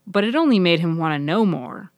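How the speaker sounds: usual speaking voice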